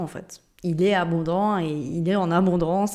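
normal talking voice

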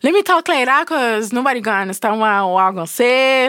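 rapid Saint Martin patois, rushed; fast patois. Retold or imitated. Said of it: "my patois, that's when I'll be like".

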